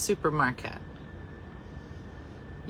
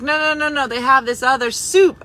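higher, louder imitation of someone insisting; higher mock protest; insistent retold objection; 7 semitones higher, 7 dB louder than usual. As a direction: act out an eager correction, pitched up. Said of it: "They're like".

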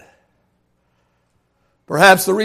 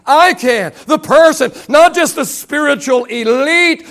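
driving, raised-pitch preaching in a building list; full-force preaching; 5 semitones higher, 3 dB louder than usual. Real, not acted. Preacher telling the church it is not just the elite, full-force preaching. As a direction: pile up each phrase with fervour.

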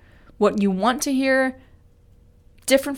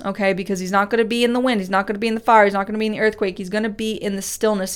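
ordinary delivery

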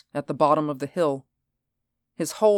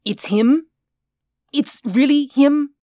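high, louder, eager disbelief; can't believe the luck; excited disbelief; 10 semitones higher, 6 dB louder than usual. Acted. Reinhardt sees the body.

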